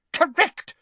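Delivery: injured official answering crossly; angrily. A narrator's reading. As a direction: bite the word off in anger. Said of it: "said Ogden angrily".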